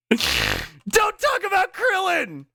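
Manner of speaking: high, drawn-out mock yell; imitated outburst. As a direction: scream it, stretching the name. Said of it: "It's got the same energy".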